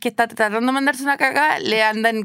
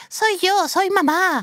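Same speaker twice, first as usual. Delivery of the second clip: mom's call at the door; 6 semitones higher, slower than usual. Retold or imitated. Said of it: "les voy a decir".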